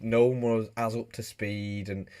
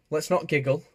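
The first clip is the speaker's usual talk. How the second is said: mock stern; 6 semitones higher than usual. Playful scolding.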